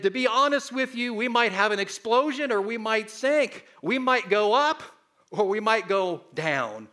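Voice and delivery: loud, high captain's warning. Retold or imitated. Preacher voicing the captain to a frightened sailor, loud and high. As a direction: boom out the old captain's warning.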